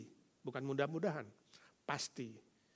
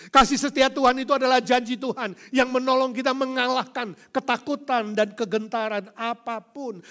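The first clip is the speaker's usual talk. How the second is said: loud, high preaching climax; rising fiery sermon; preaching at full force; 7 semitones higher, 13 dB louder than usual. Acted. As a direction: thunder it from the pulpit. Preaching loud, half sung.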